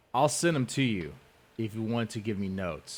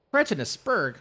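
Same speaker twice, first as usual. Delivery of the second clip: high, louder pompous mimicry; mocking imitation; 9 semitones higher, 5 dB louder than usual. Savouring the rival's insult in a high mock voice. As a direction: ham up the phrase.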